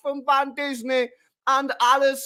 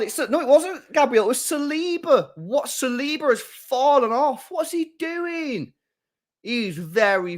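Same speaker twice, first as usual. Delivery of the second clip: normal talking voice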